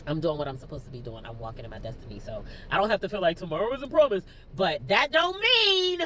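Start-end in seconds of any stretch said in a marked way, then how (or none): 4.6-6.0 rising, drawn-out cry; tearful. Real, not acted. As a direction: voice climbs high and loud into tears. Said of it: "getting on here crying".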